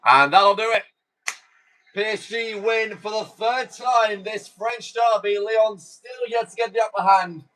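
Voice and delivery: high-pitched full-time call, drawn out; play-by-play commentary. Acted. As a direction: announce the win like a TV commentator. Calls the final whistle.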